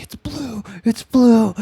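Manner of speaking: high-pitched fanboy squeal, imitated; mock thrill at spotting a famous guitar; awestruck excitement. Bassist thrilled to see famous guitar.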